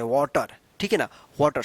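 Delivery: वाटर said "waw-tar"; rounded aw in वाटर; correct "aw" vowel. Shown as a demonstration. Teacher explaining the rule to learners, with aw. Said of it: "औ उच्चारण होता है".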